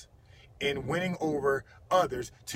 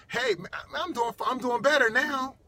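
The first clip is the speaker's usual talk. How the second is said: high, perked-up reply, building louder; lifted up; 10 semitones higher, 5 dB louder than usual. Retold or imitated. Person on the phone cheered by the caller, excited. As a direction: brighten up suddenly with rising energy. It lifts them up.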